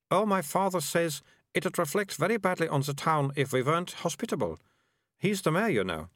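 put-on earnest, self-important girl; lightly voiced girl. Melissa speaks to Morris.

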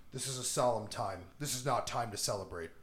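low, soft, grave retelling; mock solemn president. President hushing the celebration, mock solemn. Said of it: "the president's like".

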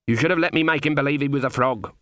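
plain reading voice

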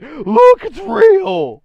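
very high, loud mock-excited shout; hammed-up high-pitched kid yelling; excited high school kid. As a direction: squeal it like an overeager kid. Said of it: "kid in high school would have tried to show us".

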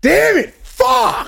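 loud, high-pitched cursing; two sharp shouted swears; frustrated outburst. Real, not acted. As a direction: explode over a blown answer. Mock outburst at missing the joke.

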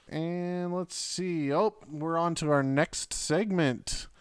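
slow, spooky drawn-out introduction; scary announcer voice. Acted. Host introducing Grayson's reading segment, hammed-up spooky. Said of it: "You sounded scary".

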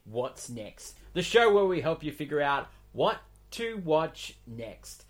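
big, loud show-host intro; announcer voice. Acted. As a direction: welcome them like a TV announcer.

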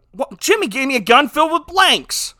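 high, loud comic outburst of disbelief; mock astonishment. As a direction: exclaim it like a sudden outraged discovery. Acts out Adam's shocked realization.

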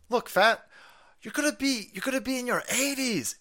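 high, put-on voice of a worried friend; a squeaky voice for a pal warning him; mock concerned critic. A friend warning Biden about his age, high and put-on. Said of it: "when people were like".

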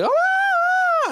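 very high, startled "Oh"; startled squeal. Retold or imitated. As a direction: squeal it like a girl caught off guard. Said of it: "they're like".

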